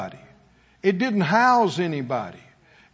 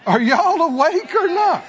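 high, louder challenge to the crowd; calling out; 9 semitones higher, 5 dB louder than usual. Real, not acted. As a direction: rouse a drowsy congregation. Preacher calling out to congregation.

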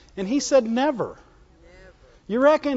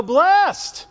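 called out; 6 semitones higher, 3 dB louder than usual. Acted. Preacher calls out the key word.

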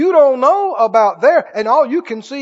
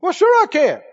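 mock boast; 9 semitones higher, 3 dB louder than usual. Mocking the boaster's reply.

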